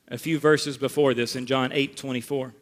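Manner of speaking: ordinary talk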